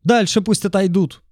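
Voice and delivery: high shout from the window; squeaky man's voice. A narrator's reading. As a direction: yell it shrill and fussy. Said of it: "выкрикнули из окна".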